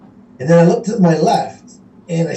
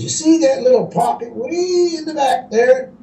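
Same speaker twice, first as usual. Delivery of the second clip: put-on teasing old angler; 7 semitones higher than usual. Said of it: "He says".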